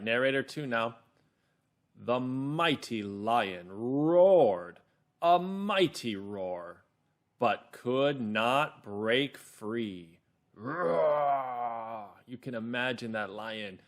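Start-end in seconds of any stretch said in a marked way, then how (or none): 2.0-10.2 very slow, emphatic storytelling; calm, clear narrator. Shown as a demonstration. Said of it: "my narrator voice is calm and clear". 10.5-12.1 long, straining growled roar; lion roar. Shown as a demonstration. Imitates the trapped lion's roar.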